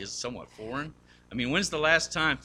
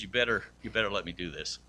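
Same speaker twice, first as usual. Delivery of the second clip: lower, softer firm voice; gently insist, lower; a hint of firm Jesus; 6 semitones lower, 3 dB quieter than usual. Jesus to Peter, lower firm insistence. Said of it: "The Lord says".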